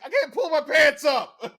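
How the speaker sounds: high panicked shout, imitating; mock panicked shout. Imitating a buddy stuck with his pants down, high shout. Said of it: "he just starts yelling".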